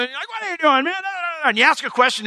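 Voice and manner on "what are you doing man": high-pitched mock exasperation; imitating an annoyed sibling's outburst; scolding older brother. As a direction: jump on a little brother. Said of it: "see your little brother doing something".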